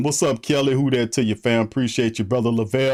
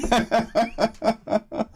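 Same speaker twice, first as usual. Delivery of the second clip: high cackling laugh; real amused laugh; 10 semitones higher than usual. Real, not acted. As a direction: laugh at his own joke. Laughs after the joke.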